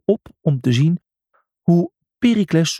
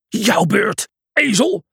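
shouting; 9 semitones higher, slower than usual. Acted. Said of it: "schreeuwde hij".

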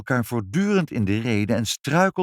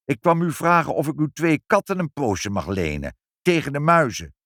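high plaintive plea; complaining; 7 semitones higher, 4 dB louder than usual. A narrator's reading. Inspector asks to borrow two cats, plaintively. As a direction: wheedle with a wounded whine. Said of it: "klaagde meneer Pinkepank".

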